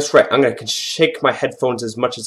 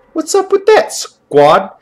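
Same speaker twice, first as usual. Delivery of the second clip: squeaky-high playful protest; high-pitched mock complaint; 14 semitones higher, 4 dB louder, slower than usual. Gamer asking squad why they jumped, high mock complaint.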